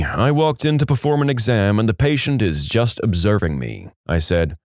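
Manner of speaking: usual speaking voice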